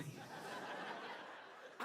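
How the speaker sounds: breathless airy laugh with no voice; silent wheezing laugh. Real, not acted. Cracking up at her own joke, a silent wheezing laugh.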